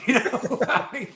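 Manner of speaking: laughing through the words; giggly, high and breathless; real laughter breaking up the words. Real, not acted. Laughing after the story punchline.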